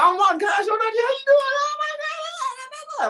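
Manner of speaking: put-on very high, gushing girly roommate voice; roommate's high-pitched excited greeting. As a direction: squeal it like an overexcited friend, drawing out the last one. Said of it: "So usually she's like".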